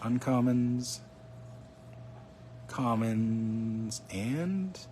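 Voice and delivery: ordinary delivery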